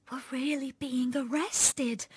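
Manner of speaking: slow, high, breath-only whisper; hushed little girl's whisper. A narrator's reading. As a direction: whisper it slowly in disbelief. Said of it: "I whispered".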